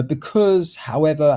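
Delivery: "Because" high and drawn out, "however" coming down; drawn-out linking words that step the tone down; stretched connecting words. Shown as a demonstration. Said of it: "kind of stretch it out a bit".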